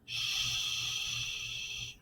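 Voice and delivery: "Shh" as a long isolated consonant; unvoiced, drawn-out "Shh" phonics sound. Shown as a demonstration. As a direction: hold the "Shh" sound steadily. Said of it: "we hear that".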